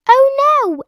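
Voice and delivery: high, loud mock dismay; cry of alarm. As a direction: gasp it out in alarm. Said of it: "everyone cried".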